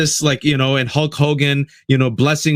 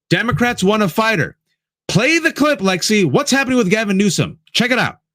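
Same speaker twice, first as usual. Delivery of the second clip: excited; 8 semitones higher than usual. Real, not acted. Host hyped, calling for clip.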